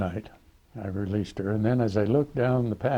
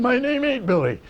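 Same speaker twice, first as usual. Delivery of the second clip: put-on much higher, louder kid voice; whiny kid's insistence; 5 dB louder than usual. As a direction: squeak it out like a cornered teenager.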